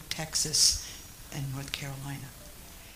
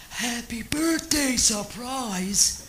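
high, slow, louder cry of happy birthday; party guests springing a surprise, mock cheering shout; retold surprise shout; 7 semitones higher, 7 dB louder, much slower than usual. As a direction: sing out the surprise like the whole party.

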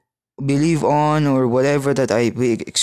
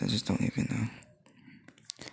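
whispered aside; 2 semitones lower, 14 dB quieter, slower than usual. Real, not acted. Muttered despairing aside to self.

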